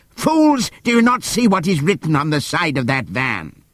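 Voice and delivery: high, exasperated yell; donkey shouting at blind animals; angry shout. Acted. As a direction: make them see it. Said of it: "shouted Benjamin".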